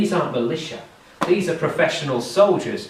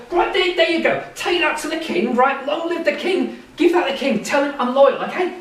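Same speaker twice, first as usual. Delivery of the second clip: much higher, eager and pleading; eager desperate mayor; 11 semitones higher than usual. Acted. Desperate mayor offers gold to the captain for the king. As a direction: push the gold on him anxiously.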